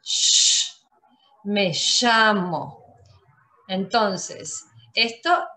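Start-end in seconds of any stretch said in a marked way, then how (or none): 0.0-0.7 a long hissed "Sh" like the Argentine ll; sh sound. Shown as a demonstration. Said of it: "Una frase con doble L". 1.4-3.0 "llamo" said "shamo", slow; the ll in "llamo" as sh, drawn out; Argentine sh. Shown as a demonstration. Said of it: "Una frase con doble L".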